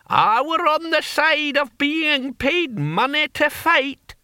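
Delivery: put-on very high, slow old warrior voice; high querulous barbarian cry; deaf old barbarian. A narrator's reading. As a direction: crow the answer at a high pitch, stretched out. Deaf old barbarian answers proudly.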